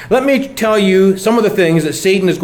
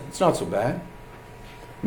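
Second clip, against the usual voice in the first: soft, breathy whispered temptation; the tempter's hushed voice in your ear; tempting whisper; 4 semitones lower, 14 dB quieter, much slower than usual. Acted. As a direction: whisper it like a sly inner voice. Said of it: "that little whisper in your voice".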